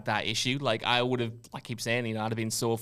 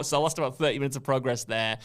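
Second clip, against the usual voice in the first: ordinary delivery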